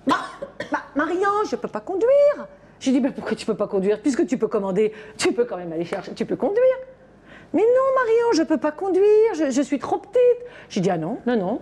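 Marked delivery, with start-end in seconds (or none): 0.1-2.1 put-on higher, childish protest; little girl's voice. Nanny voicing the little girl refusing to drive, higher childish voice. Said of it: "Puis elle, elle me fait". 7.5-10.2 put-on much higher, louder childish whine; five-year-old girl. Nanny imitating the girl who says she's too small, high whiny child voice. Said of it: "Faites bien, enfant de cinq ans".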